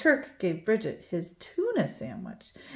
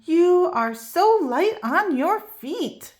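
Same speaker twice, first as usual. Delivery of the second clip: admiring; 7 semitones higher, 3 dB louder, slower than usual. A narrator's reading. Dino praising dance partner.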